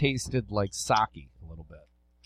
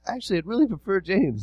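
higher mock voice of Jimmy asking to be called James; imitating Jimmy; 9 semitones higher than usual. Podcast host mocking listener Jimmy, in a high pleading mock voice. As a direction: play the friend pleading to be called James, pitched up. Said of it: "He's like".

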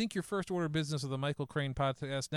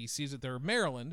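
normal talking voice

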